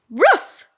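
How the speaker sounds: high loud bark; dog bark. Acted. Hilda barks bursting into the shed.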